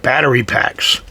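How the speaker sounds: ordinary talk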